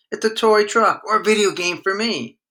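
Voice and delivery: put-on high, insistent little boy voice; young son arguing over the gift; protesting boy. A narrator's reading. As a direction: claim the present like an eager kid brother. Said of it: "her brother protested".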